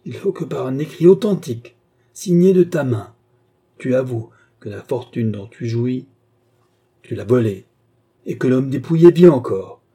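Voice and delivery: loud, emphatic ultimatum; forceful demand. A narrator's reading. Bastien demands a written confession, forceful and accusing. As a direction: hammer out each condition loudly.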